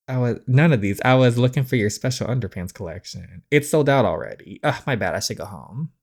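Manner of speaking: ordinary talk, nothing marked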